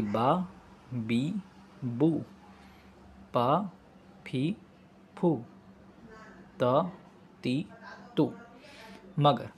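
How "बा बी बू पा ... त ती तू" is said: बा, बी, बू each said slowly, one at a time; syllables sounded out. Shown as a demonstration. Teacher sounds out consonant-vowel syllables for students. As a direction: drill each syllable apart for learners. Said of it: "वैसे ही".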